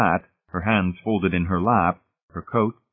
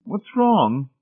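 high-pitched anxious matron; 13 semitones higher than usual. A narrator's reading. Mrs Pritchett inquiring around the table, anxious. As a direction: ask with fussy, high-voiced worry. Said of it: "Missus Pritchett inquired anxiously".

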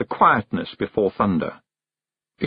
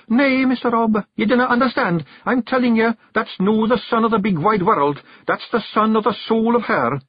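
put-on much higher boyish Scots voice, urgent; young Billy, insistent; 12 semitones higher, 3 dB louder than usual. A narrator's reading. Billy insists to Rob about the sun, urgent high voice. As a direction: stand your ground with the chief.

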